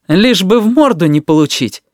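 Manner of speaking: high, taunting question; mocking. A narrator's reading. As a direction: needle him with a mocking lilt. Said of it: "насмешливо спросил Смирняков". (насмешливо, высоким голосом)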